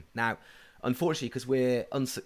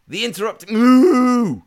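imitated cow moo; 11 semitones higher, 13 dB louder than usual. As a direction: burst in as an interrupting cow. Child's interrupting cow punchline.

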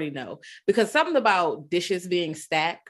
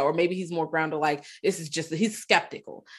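ordinary talk

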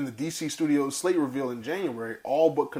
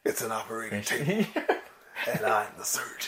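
menacing quiet; 4 semitones lower, 4 dB quieter than usual. Retold or imitated. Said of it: "quoting Peter Weller line".

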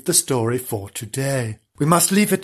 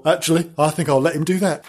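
usual speaking voice

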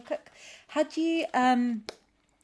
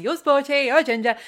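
loud, raised-pitch imitation; mock announcing; 6 semitones higher, 11 dB louder than usual. Imitating TV pop presenters labelling the Spice Girls, mock announcing. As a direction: call out the labels like a pop-show host.

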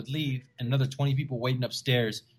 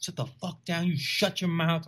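retold cussing back; 5 semitones higher than usual. Comic cusses back at hecklers from stage, mock anger. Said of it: "I start cussing at them back".

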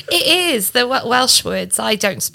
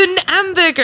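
mock French; 8 semitones higher, 4 dB louder than usual. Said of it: "I'm like".

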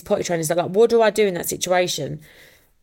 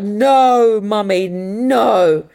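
put-on high, loud, slowly drawn-out child's protest; a small boy flatly refusing, dragging the words out; toddler voice; 3 semitones higher, 7 dB louder, much slower than usual. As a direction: toddler wailing no at his mum in the car park. Toddler son voiced in story.

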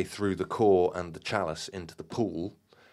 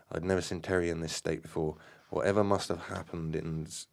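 ordinary delivery

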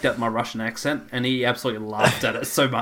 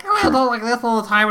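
squeaky high mock voice; high-pitched imitation of the friend; mock high modulated voice; imitating high modulator voice; 10 semitones higher, 4 dB louder than usual. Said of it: "you went from sound like".

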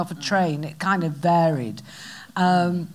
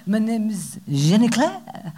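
fake French; slower than usual. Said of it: "pretending to be French in a nightclub".

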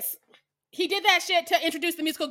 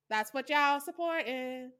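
usual speaking voice

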